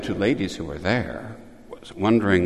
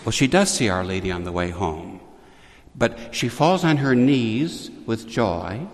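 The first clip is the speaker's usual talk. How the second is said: normal talking voice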